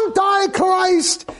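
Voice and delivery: high, drawn-out heckling cry; imitated heckler's cry. Protestant heckler interrupting the Pope's speech, high drawn-out cry. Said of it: "you hear this voice. He goes".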